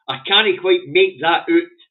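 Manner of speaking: slow, loud, exasperated. Real, not acted. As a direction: spell out your frustration slowly. Frustrated at the headline.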